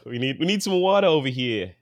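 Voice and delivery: put-on New York accent; New Yorker accent. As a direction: do a quick New Yorker impression. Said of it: "That was my New Yorker".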